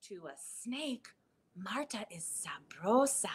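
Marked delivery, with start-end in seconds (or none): 1.5-3.3 sneaky whisper; hushed whisper. Acted. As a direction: whisper it like the snake is close. Snake sees tasty Marta.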